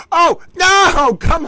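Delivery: sudden loud high cry; shout. Real, not acted. Gamer yelling as the jump fails. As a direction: yelp it out in dismay.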